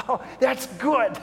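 lightly voiced little girl, pitched high; a hint of a relieved little girl; relieved child. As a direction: play the child's simple relief. Child relieved by good news.

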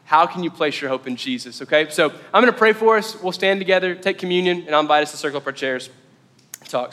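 usual speaking voice

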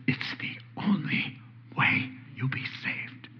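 soft, breath-only whisper; very quiet whispered quote; hushed whisper. Acted. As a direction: whisper it as a solemn secret. Quotes Jesus in a hush.

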